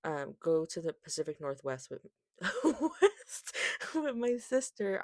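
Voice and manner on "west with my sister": laughing through the words; giggling at her own slip. Real, not acted.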